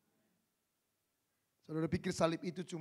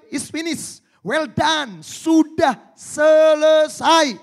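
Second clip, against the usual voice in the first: triumphant shout; 10 semitones higher, 22 dB louder, much slower than usual. Acted. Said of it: "Dan dia berkata di kayu salib".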